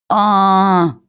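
long loud high wail of frustration; wailing scream. A narrator's reading. Daughter howls in despair at her mother's praise, a loud high wail. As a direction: let out a desperate howling "а-а-а". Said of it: "вопила я".

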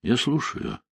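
quiet, worn-out answer on the phone; a wife weary at night picking up; lightly voiced tired wife. A narrator's reading. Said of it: "ответила усталым голосом жена".

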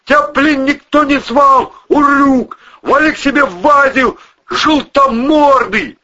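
high, loud hurled insults; angry yelling. Acted. Man cursing the monster outside, angry yelling. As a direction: shout abuse through the door.

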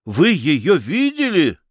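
high-pitched, drawn-out question in amazement; astonished. A narrator's reading. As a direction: ask it in amazed disbelief. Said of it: "ахнул Федяшев". (поражённо, ахая)

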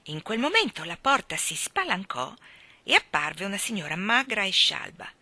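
ordinary delivery, nothing marked

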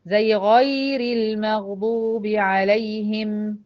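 غير المغضوب عليهم chanted very slowly in Quran style; slow Quran recitation of غير; recited soft ya. Shown as a demonstration. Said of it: "ياء لينة".

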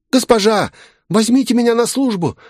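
put-on very high-pitched voice of a crying boy; tearful pleading. A narrator's reading. Street boy begging a lady to take him into service, tearful. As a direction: plead desperately in a high, whining child's voice. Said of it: "заплакал он".

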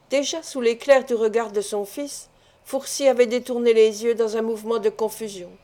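ordinary delivery, nothing marked

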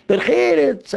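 high mock cry; retold shouting. Young man heckling the rabbi in his tallis, high retold shout. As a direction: imitate a heckler's outcry, pitched far up. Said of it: "schreit noch einmal".

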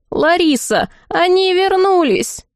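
high, drawn-out call to someone. A narrator's reading. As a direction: call the news through the house, high and slow. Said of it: "во всё горло завопил дворецкий".

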